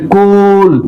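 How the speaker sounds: cool with a drawn-out "oo"; long vowel. Shown as a demonstration. Teacher reading the word list, long vowel in cool. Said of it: "long vowel sound".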